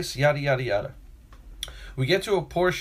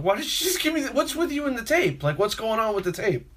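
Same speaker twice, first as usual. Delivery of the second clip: much higher, incredulous demand; mock bewildered, voice pitched way up; baffled complaint; 9 semitones higher than usual. Worker baffled by supervisor about the tape, incredulous.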